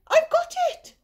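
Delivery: high, loud eureka cry; the snail crying out her bright idea; excited cry. Acted. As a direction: burst out with the idea. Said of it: "she cried".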